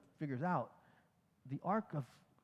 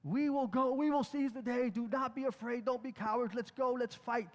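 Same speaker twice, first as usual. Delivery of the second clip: raised, higher pep-talk shout, slowed on the first line; rally frightened troops like a general; rallying battle cry; 6 semitones higher than usual. Retold or imitated. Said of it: "he talked to the team and he's like".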